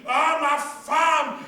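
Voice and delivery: loud, drawn-out cry of ecstasy; husband cries out. Acted. Husband cries out to his wife, loud and drawn out. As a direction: bellow it slowly. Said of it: "L'homme s'écrie".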